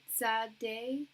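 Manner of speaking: "sad day" with short flat-A "sad", questioning rise; name said as SAD DAY. Retold or imitated. Reader checks a viewer's name, pronounced as SAD DAY. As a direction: say "sad day" like two English words. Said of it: "it's pronounced Sad Day".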